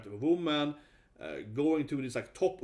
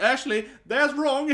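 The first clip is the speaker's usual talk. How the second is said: smug correction; 10 semitones higher, 7 dB louder than usual. Retold or imitated. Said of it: "I was that besserwisser kid".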